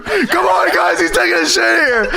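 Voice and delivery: high-pitched mock yell, laughing; imitating yelling announcement. Co-host acting out summoning everyone to the bathroom, mock yell. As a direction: call everyone over gleefully. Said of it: "opens the door and yells at everybody".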